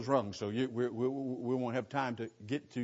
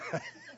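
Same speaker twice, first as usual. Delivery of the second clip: high laugh; 8 dB quieter than usual. Real, not acted. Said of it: "Get my gun".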